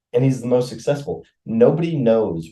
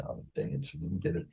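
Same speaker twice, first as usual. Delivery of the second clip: muttered disappointment; 8 semitones lower, 14 dB quieter than usual. Retold or imitated. Reacting quietly to failed take.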